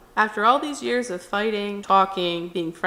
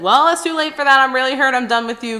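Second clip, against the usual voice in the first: loud, high start settling into firmness; acted hurt, cutting him off; retold angry rejection; 5 semitones higher, 7 dB louder than usual. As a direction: tell off an ex, emphatically. Acted angry breakup reply.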